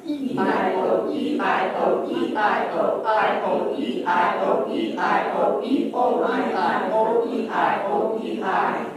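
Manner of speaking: loud, drawn-out chanted vowels; warm-up chant on a single breath; one-breath diction exercise. Shown as a demonstration. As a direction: stretch each vowel fully and keep it rolling. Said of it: "we try to do it in one breath".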